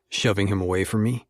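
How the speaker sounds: usual speaking voice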